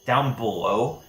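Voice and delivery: usual speaking voice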